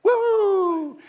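high, loud "woo!" cheer; happy whoop. Acted.

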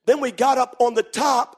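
ordinary talk, nothing marked

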